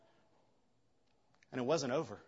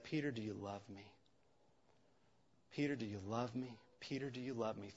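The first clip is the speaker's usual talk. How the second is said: gentle tender question; 4 semitones lower, 10 dB quieter than usual. Acted. Jesus gently asking Peter.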